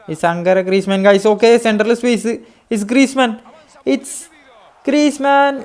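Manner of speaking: rising from loud to a high screaming call; building goal call. Real, not acted. Gamer calling Griezmann's goal, rising excited shout. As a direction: build the hype to a peak on the name.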